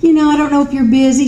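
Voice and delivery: high whining plea, mock helpless; whiny. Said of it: "She didn't whine about it at all".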